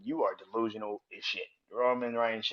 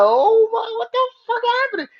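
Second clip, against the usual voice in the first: very high, loud shocked cry; shocked shriek; 12 dB louder than usual. Retold or imitated. Sami, imitated, shrieking in shock at Roman. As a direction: shriek it in disbelief. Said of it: "he was like".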